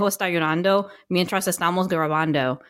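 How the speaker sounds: mock American-accented Spanish; imitating accentless classroom Spanish. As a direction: run through the Spanish with English vowels and r. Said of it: "no accent".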